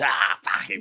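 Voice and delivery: low gruff mock hardman growl, slowed; imitating Wayne. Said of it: "he sounds like Benny Butcher from The Boys".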